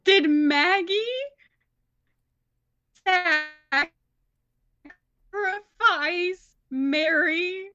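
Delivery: loud, drawn out with long pauses; dramatic cliffhanger. Acted. Player posing the episode's cliffhanger question, loud and dramatic.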